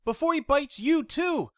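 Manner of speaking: high, loud warning holler. Acted. Jackie warning Paxton away from Tony, high holler. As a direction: yell a warning across the hall. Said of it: "Jackie hollered over my screams".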